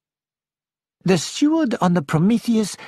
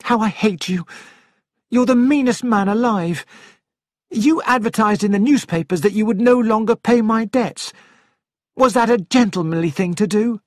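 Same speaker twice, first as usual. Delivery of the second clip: furious wife; 5 semitones higher than usual. Acted. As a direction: spit out hatred at him. Wife rages at husband.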